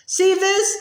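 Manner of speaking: high, forceful bellow. A narrator's reading. Gaunt bellowing at Ogden over the locket, high bellow. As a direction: thrust it at him, bellowing. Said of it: "he bellowed at Ogden".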